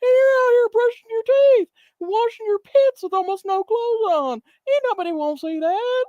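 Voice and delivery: put-on high, reedy old man voice scolding; old man impression. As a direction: whine about the walk with Mittens. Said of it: "he's like".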